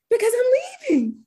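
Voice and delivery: very high, fast, chirpy answer; high quick giddy retort; gleeful reply. Retold or imitated. Her gleeful answer to clients.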